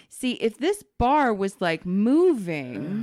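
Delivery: imitating a tech teaching the obvious; key words drawn out slowly; patronizing explanation. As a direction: explain it very carefully, as if to a beginner. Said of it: "is literally explaining to me".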